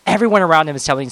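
ordinary talk, nothing marked

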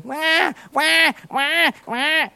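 high, quacking mock baby wail; baby's shouted cry. Parent showing how babies keep shouting at them, quacky mock cry. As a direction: imitate a newborn's repeated wahs, like ducks. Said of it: "they just keep shouting at me".

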